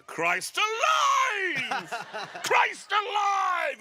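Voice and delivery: very high, loud, drawn-out howl; father's horrified exclamation. Retold or imitated. Son mimics dad howling in shock at his perm, high slow shout. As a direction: stretch it out in outraged disbelief. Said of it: "the way he said this".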